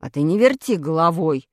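low-voiced, mildly guilty retort; guilty mumble. A narrator's reading. Pashka excusing his missed throw, low guilty grumble. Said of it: "Виновато пробубнил Пашка".

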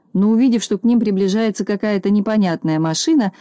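ordinary delivery, nothing marked

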